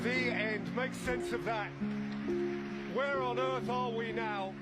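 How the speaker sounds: ordinary talk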